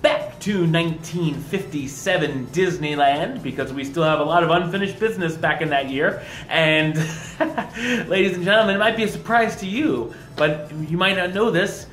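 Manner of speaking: loud upbeat show host; announcer. Acted. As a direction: hype up the episode. Podcast show intro.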